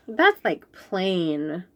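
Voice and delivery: usual speaking voice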